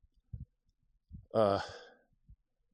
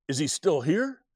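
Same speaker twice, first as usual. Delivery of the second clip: higher-pitched, mildly alarmed question; worked-up mom; 7 semitones higher, 4 dB louder than usual. Retold or imitated. Mom questioning her child about the man, a bit worked up. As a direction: imitate a mother getting worked up. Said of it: "she was getting worked up".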